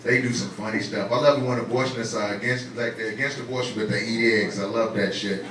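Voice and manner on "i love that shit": low, soft relish; low aside. Real, not acted. Comic on abortion opponents eating eggs, low aside. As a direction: drop the pitch and volume, savoring it. First take of repeated punchline.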